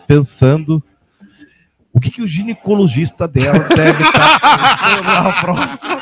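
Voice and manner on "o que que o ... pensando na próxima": giggling through the words; laughing while joking. Real, not acted.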